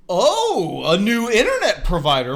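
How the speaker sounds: high, loud, hammed-up gullible voice; mock gullible customer. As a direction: play the dupe swept up by the pitch. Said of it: "the kind of guy that would be swept up like".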